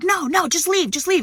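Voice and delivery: high-pitched flustered-man imitation; mock panicked protest. Man refuses the cops, wants them gone, mock protesting. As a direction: mimic him frantically backing down. Man backing down, retold in quarrel.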